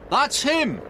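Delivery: high, loud excited cry; excited shout. A narrator's reading. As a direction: yell it pointing at the rider. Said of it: "shouted Frank excitedly".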